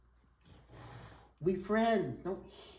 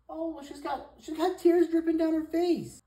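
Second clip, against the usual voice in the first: very high, swelling loud on the tears; high pet-talk coo; 13 semitones higher, 8 dB louder than usual. Real, not acted. Cooing over cat's tears.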